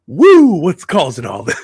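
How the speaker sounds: loud high woo then slow growl; Ric Flair impression. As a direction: belt it out like a wrestling legend. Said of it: "always a pleasure to hear him on the mic".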